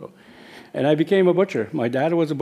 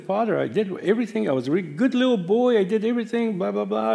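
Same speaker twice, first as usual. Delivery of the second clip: put-on much higher, a boy's pleading protest to the priest; retold angry protest; 9 semitones higher than usual. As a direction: whine it up high like an indignant kid. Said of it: "he got me angry".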